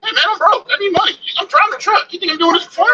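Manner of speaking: pleading high and whiny, like a broke driver; high broke trucker voice. Pretend. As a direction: whine about needing money. Comic broke trucker plea.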